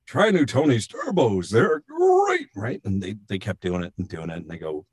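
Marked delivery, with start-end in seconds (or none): 0.1-2.5 put-on old Tony the Tiger, voice cracking high; Thurl Ravenscroft. Retelling the old actor's failing tagline take, cracking voice. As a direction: imitate an ageing mascot actor straining up on GREAT. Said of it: "it was cracking".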